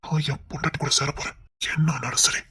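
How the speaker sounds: low, unvoiced whisper. A husband quietly whispering his vow to find his wife. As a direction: breathe the vow to himself.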